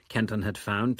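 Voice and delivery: ordinary delivery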